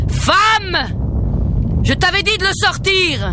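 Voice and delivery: furious roar; ogre yelling. A narrator's reading. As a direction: bawl at the wife. Ogre yells at wife.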